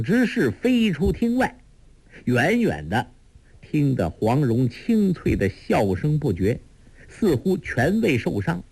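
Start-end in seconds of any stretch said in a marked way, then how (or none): none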